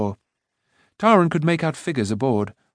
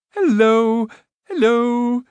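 high, loud cheerful greeting; calling out; 11 semitones higher, 5 dB louder, much slower than usual. A narrator's reading. Rune calling to the companions from shore. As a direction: call out gaily from the water. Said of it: "he called, waving a dripping hand".

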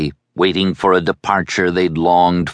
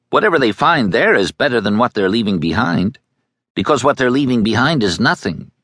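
annoyed; 8 semitones higher than usual. A narrator's reading. Boy losing patience with the girl's questions, annoyed and fast. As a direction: snap at her impatiently. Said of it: "He shifted suddenly, in annoyance".

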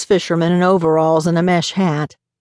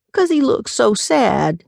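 higher, slow doting coo; cooing to a dog; 6 semitones higher, slower than usual. Acted. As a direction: coo it like a grandma spoiling her pet. Grandma cooing over the dog.

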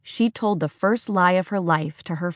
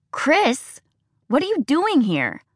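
higher, startled call from above; surprised young man; 8 semitones higher than usual. Acted. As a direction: wake up surprised. Logan at the window.

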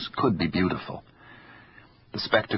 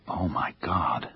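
breathless, slow whisper of shock; hushed gasp of disbelief; stunned whisper; 3 dB quieter, slower than usual. Acted. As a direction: barely breathe the words out. She froze at the money.

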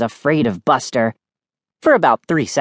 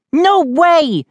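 high-pitched indignant protest; angry shout; 12 semitones higher, 4 dB louder than usual. A narrator's reading. Sister cheated out of a point, shouting angrily. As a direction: object hotly, pitch way up. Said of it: "Mindy shouted angrily".

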